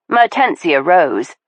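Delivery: ordinary delivery, nothing marked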